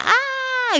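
high, held mock scream kept soft; demonstrated scream. Shown as a demonstration. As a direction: demonstrate a yell without full volume. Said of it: "empiezas a gritar".

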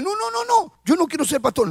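high, rushed protesting no no no; panicked man backing away from the job; new convert refusing to be named pastor, squeaking high; frantic refusal. Retold or imitated. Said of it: "Y yo dije".

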